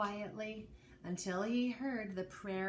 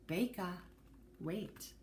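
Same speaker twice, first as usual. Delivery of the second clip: gentle hush; 5 semitones lower, 7 dB quieter than usual. A narrator's reading. Grandma gently calms Johnny.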